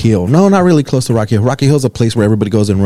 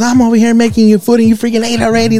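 mock scolding; 11 semitones higher than usual. Imitating the wife scolding her husband for eating already. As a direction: scold him like a tired wife who cooked for nothing.

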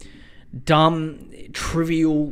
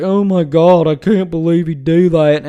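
loud, put-on gasping outrage; mock the shocked crowd; hammed-up disbelief; 9 dB louder than usual. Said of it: "everyone's like".

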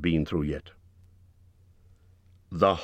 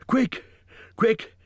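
much higher, breathless alarm; urgent gasp; 3 dB louder than usual. A narrator's reading. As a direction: gasp it out, voice shooting up in panic. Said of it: "he gasped".

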